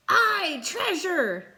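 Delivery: high, loud cheer of pirates; pirate crew shout. Acted. Pirate crew cheers about treasure, high shout. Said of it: "the others shouted".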